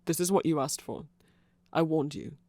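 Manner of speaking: usual speaking voice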